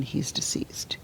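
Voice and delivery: hushed, hollow whisper; echoing whisper. Retold or imitated. Mother replaying the officer's news, whispered. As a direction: whisper it like it won't sink in.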